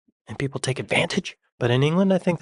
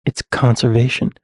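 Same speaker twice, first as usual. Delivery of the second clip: ordinary talk, nothing marked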